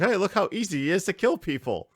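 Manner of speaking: high, mocking sing-song; taunting tutorial voice. A game tutorial mocking the new player, high and smug. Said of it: "the tutorial sets you up for failure 'cause it's like".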